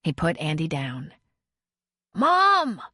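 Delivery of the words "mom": high, loud yell for mom. A narrator's reading. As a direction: shout it out desperately. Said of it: "he yelled".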